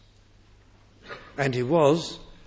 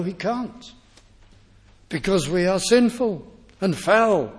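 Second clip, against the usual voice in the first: normal talking voice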